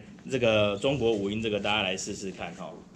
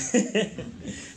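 short, breathy higher laugh; real chuckle; 9 semitones higher than usual. Real, not acted. Teacher jokes about the transposed track, real chuckle. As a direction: chuckle at your own joke.

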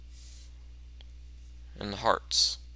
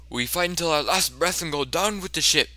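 raised, dramatic last-stand speech; rally the crew with hammed-up resolve; mock heroic captain; 5 semitones higher, 3 dB louder, faster than usual.

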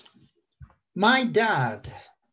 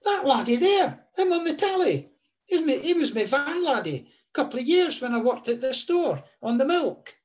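high, eager Scottish retelling voice; lightly voiced Scottish dad, pitched up; excited Scottish dad; 10 semitones higher than usual. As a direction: imitate dad insisting in Scots. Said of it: "he said".

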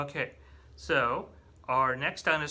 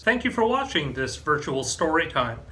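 louder, upbeat promo read; closing announcement; 7 dB louder than usual. Acted. Librarian [name] closing story time with a library plug.

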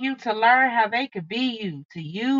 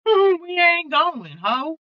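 laughing it out again, higher and slower; 8 semitones higher, 6 dB louder, much slower than usual. Real, not acted. Tarot reader repeating her line to viewers, laughing. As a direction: stretch the line through a laugh.

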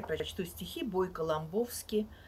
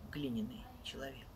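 fast whisper, trailing off; whispered ending; 5 semitones lower, 8 dB quieter than usual. Acted. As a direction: hush the last words away. Poem ending dropped to hush.

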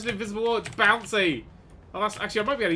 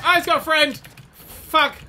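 high, loud yelp; alarmed shout; 11 semitones higher, 9 dB louder, slower than usual. Real, not acted. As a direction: yell out at the sudden threat. Second enemy appears.

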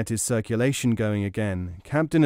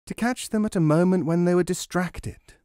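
put-on young woman's voice, pitched higher; a girl's higher voice, proud of her plan; Noor voiced higher; 7 semitones higher than usual. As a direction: lift the voice into Noor, pleased with herself. Noor explains her timing.

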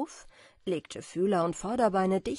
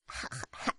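soft laugh; 6 dB quieter than usual. A narrator's reading. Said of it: "ein leises Lachen".

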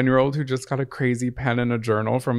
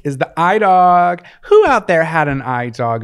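loud emphatic; 4 semitones higher, 8 dB louder than usual. Real, not acted. Announcing crush of the week.

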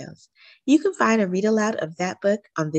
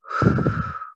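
deep sigh. A narrator's reading. Deep breath in the exercise.

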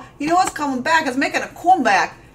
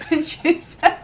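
normal talking voice

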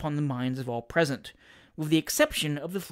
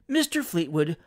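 ordinary delivery

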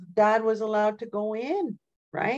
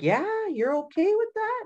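way up high, a mock stunned husband asking; surprised husband; 14 semitones higher than usual. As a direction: play the husband in hammed-up disbelief. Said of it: "He was like".

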